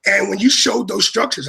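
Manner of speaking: normal talking voice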